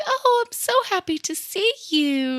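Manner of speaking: high, sweet little elephant voice; high cutesy toy voice. Retold or imitated. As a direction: welcome them in like a sweet tiny toy. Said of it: "the cutest, like, little voice".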